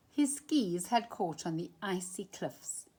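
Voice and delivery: ordinary delivery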